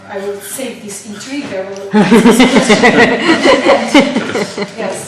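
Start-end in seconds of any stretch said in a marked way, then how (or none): 1.9-4.7 loud burst of laughter; hearty laugh. Real, not acted. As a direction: laugh out loud, long and free. Teacher laughs at own joke.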